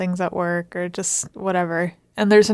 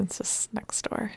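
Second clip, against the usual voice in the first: whispering; 10 dB quieter than usual. Real, not acted. Noise from the office next door.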